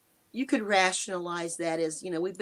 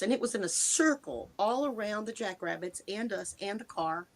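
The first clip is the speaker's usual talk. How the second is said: usual speaking voice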